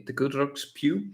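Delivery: ordinary talk, nothing marked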